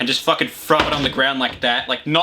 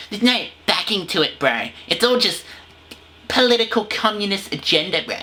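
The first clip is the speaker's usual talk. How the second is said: higher, hammed-up bro voice; mock conspiracy bro; 5 semitones higher than usual. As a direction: sneer like a conspiracy dude.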